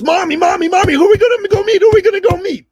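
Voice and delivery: hammed-up, high loud mock yell of an excited boy; boy energy. Twelve-year-old boy pestering his mother about who they'll meet, hammed-up excited yelling. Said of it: "he's got boy energy coming right at me".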